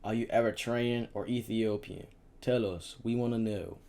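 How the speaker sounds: a touch of a barked question; shouting. A narrator's reading. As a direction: soldier interrogating the parents. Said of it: "The soldier continued shouting".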